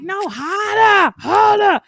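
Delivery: high, drawn-out Boston "hahdah"; Boston accent. Acted. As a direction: insist in broad Boston, R's dropped. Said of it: "we don't pronounce our R's in Boston".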